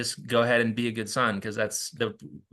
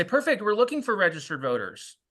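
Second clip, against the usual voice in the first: bright, higher, eager reply; upbeat reply; 7 semitones higher than usual. Shown as a demonstration. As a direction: turn the objection into good news. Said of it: "And you say".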